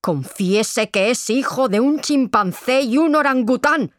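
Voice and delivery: high, climbing insistent yell; relentless interrogator's cry; shouted demand. A narrator's reading. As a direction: shout the order, pitch climbing to the end. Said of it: "gritó de nuevo el padre de Inés".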